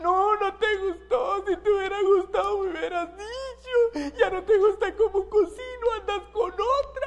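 high, hammed-up crying wife; mock weepy wail; tearful wailing. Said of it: "llora por cualquier cosa".

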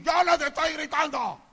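mock husband's yell; acted shouted denial. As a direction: insist loudly that you are not yelling. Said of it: "parece que estamos gritando".

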